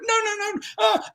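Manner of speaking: very high, panicked no no no; panicked protest. Retold or imitated. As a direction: try to stop him in a flap. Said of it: "and he panicked".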